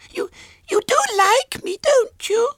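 put-on very high, loud childish pleading; tearful little girl. A narrator's reading. Small girl begs William to like her, tearful. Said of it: "Her lips quivered".

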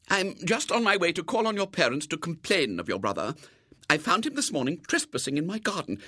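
high-pitched, indignant lady; complaining lady. A narrator's reading. Lady complains to the twin about William, indignant. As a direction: list the boy's misdeeds in a high voice.

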